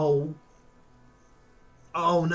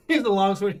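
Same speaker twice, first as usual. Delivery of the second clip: laughing; 7 semitones higher, 9 dB louder than usual. Real, not acted. Laughing at the weapon list.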